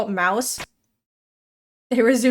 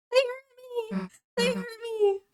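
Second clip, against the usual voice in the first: high soft whine; 11 semitones higher, 9 dB quieter than usual. Pretend. Whining at game enemies.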